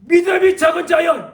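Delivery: high, loud cry of rebuke; shouted rebuke. Retold or imitated. Jesus rebuking the frightened disciples, shouting high. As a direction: thunder it out as Jesus scolding. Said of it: "예수님은 뭐라고 하셨는가?".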